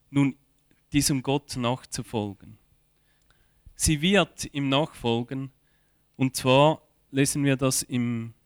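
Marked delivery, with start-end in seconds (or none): none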